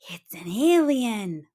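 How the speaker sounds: slow excited whisper. Acted. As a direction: breathe it out in thrill. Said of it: "whispered excitedly".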